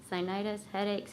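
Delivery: ordinary talk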